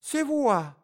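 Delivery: very high, loud exclamation of delight; joyful cry. A narrator's reading. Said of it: "закричала Глафира Петровна с неподдельной радостью". (радостный высокий возглас)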